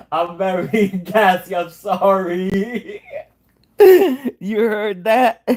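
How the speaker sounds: giggling through an embarrassed apology; laughing sorry, slow and drawn out. Real, not acted. Laughing at own fart.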